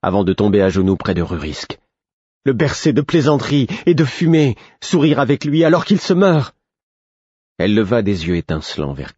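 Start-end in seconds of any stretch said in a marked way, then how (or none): 2.5-6.5 high-pitched, anguished accusation; outraged reproach. A narrator's reading. Sister to the poisoner as her brother dies, outraged reproach. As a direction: heap blame in a raised, indignant voice.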